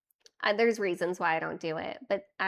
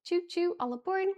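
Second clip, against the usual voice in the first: chugging toy train voice; 7 semitones higher than usual. Pretend. Streamer train hype call.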